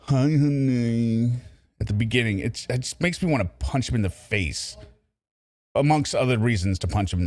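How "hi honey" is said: slow, drawn-out sappy greeting; mocking Lorne. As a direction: stretch out a syrupy hi honey. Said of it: "Just the way he's so like".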